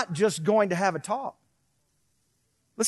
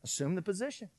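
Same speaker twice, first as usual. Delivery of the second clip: put-on soft, firm order to the child; hushed parent giving the order; stern quiet command; 3 semitones lower, 14 dB quieter, faster than usual. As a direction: say it low and controlled, no room to argue. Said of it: "and I would say".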